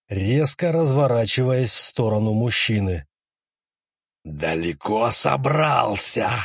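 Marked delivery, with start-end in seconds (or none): 4.2-6.3 slow, inhuman corpse voice; a dead woman's voice, dragged out slow; eldritch horror. Acted. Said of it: "раздался нечеловеческий голос".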